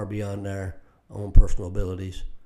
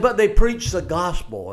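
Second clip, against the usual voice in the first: louder and higher, hammering the point; emphatic preaching; 8 semitones higher, 7 dB louder than usual. Retold or imitated. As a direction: proclaim it to the whole church. Preacher quotes Paul emphatically.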